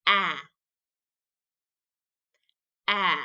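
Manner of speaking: A as the short vowel in "cat"; letter sound. Shown as a demonstration. Teacher making the sound with the child, A as short "a". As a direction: say A as "aah", not "ay". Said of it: "Can you make the A sound".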